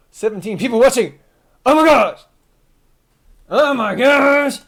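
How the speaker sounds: high, loud astonished outburst; excited amazement. Real, not acted. Streamer seeing seventeen people watching, excited amazement.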